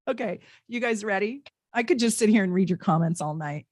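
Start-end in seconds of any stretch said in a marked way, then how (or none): none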